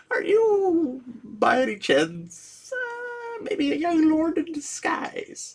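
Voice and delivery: high, slow, mock-awed captain voice; incredulous captain. Captain nervously asks the rich stranger if he's a lord, high and slow. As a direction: play the captain squeaking out his suspicion, drawn out and high.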